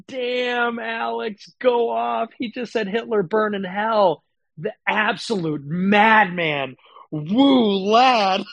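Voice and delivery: sarcastic hype. Pretend. Sarcastic mock praise.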